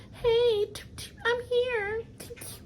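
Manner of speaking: mock timid knock.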